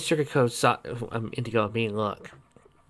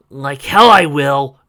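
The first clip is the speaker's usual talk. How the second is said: loud, slow retort; defiant retort; 2 semitones higher, 12 dB louder, much slower than usual. A narrator's reading. Halfling refusing to join, loud and slow. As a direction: spit it back defiantly.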